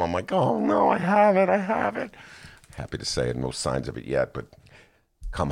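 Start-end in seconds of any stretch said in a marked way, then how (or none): 0.3-2.1 high, half-hammed panic; mock panic. Retelling his walk-home scare, in mock panic. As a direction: act like you're sure you caught it. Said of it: "I started freaking out".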